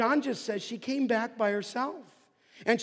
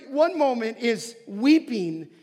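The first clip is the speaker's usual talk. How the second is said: ordinary delivery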